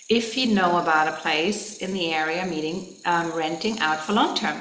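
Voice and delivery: ordinary talk, nothing marked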